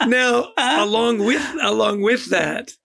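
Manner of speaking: slow, still laughing. Real, not acted. Recovering from laughter.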